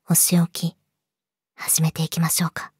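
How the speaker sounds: low menacing whisper. Acted. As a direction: hiss the threat softly. Announcing punishment.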